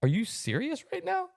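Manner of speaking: high, slow incredulous question; retold disbelief. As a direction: act out stunned disbelief, drawn out. Incredulous reply in retold quarrel.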